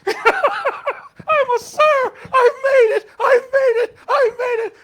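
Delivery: very high, loud, crying-out triumph; mock triumphant cry. Pastor celebrating being called sir, shouting with joy. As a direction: shout it like you won.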